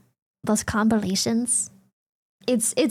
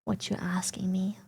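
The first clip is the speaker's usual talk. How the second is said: whisper; 6 semitones lower, 9 dB quieter than usual. Real, not acted. Unvoiced whisper.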